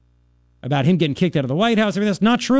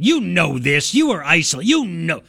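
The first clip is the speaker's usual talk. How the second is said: lightly voiced sneering old man; nasty old codger voice; 2 semitones higher than usual. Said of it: "The nastiness in that old codger's voice".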